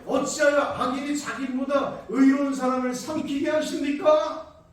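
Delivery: loud cry of why to God; anguished scripture complaint; pleading outcry. Acted. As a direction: cry it out to heaven. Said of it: "십삼 절 말씀에".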